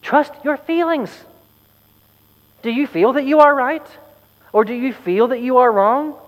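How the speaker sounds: mock-wise oracle, tartly scolding. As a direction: scold them like dim pupils. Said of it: "responded tartly".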